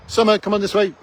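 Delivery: calling out. Real, not acted. Calling out to someone nearby.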